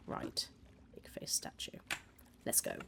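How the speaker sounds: unvoiced whisper to herself. Real, not acted. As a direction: whisper it under your breath. Muttering to herself searching.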